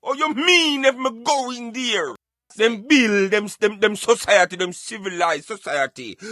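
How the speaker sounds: ordinary talk, nothing marked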